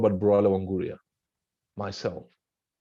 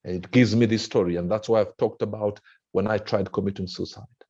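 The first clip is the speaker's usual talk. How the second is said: ordinary delivery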